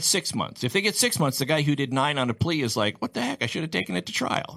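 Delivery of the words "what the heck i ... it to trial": exasperated. Retold or imitated. Defendant voiced, exasperated.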